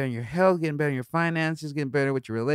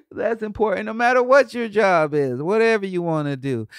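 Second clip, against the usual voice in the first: ordinary talk, nothing marked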